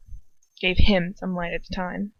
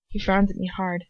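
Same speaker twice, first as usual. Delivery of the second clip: usual speaking voice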